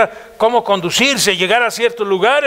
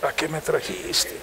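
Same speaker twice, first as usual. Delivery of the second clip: quiet lament; 7 semitones lower, 12 dB quieter, slower than usual. Retold or imitated. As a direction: lower and much softer complaint to God. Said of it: "Y decía". (lamento en voz baja a Dios)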